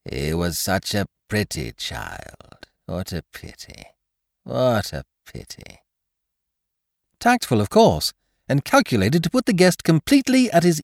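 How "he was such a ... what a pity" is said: put-on slow, soft, regretful old woman; sighing old lady. A narrator's reading. Ancient mother sighing over the pretty child. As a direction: sigh it out with pity. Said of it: "She heaved a sigh".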